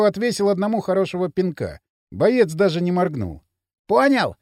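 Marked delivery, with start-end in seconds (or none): 3.9-4.4 high, loud gloating check; mildly gleeful boast; triumphant. A narrator's reading. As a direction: crow it in victory. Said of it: "торжествующе расхохотался Пакля".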